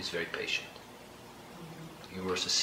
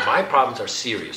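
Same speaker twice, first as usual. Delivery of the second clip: laughing through a mock-serious boast; joking while laughing; 14 dB louder than usual. Real, not acted. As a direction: laugh it out, louder. Joking self-mockery with laughter.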